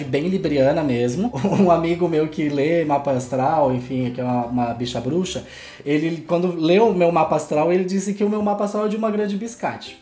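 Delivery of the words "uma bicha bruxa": usual speaking voice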